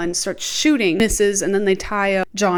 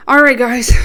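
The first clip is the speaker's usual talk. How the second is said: loud; 4 semitones higher, 10 dB louder than usual. Real, not acted. Loud call to start section.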